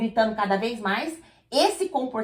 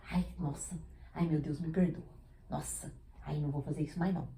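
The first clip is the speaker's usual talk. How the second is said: guilty inner voice; 8 semitones lower, 13 dB quieter than usual. Retold or imitated.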